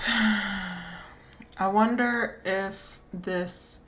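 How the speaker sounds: normal talking voice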